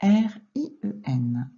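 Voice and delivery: slow, separated spelling; letter by letter. Shown as a demonstration. Teacher correcting a pupil's dictation, letter by letter. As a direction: spell it out with gaps between letters.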